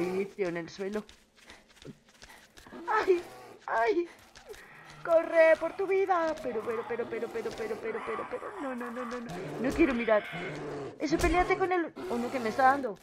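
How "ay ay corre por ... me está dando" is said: panicked high yelps; frantic cries fleeing; being chased. Real, not acted. Fleeing zombie.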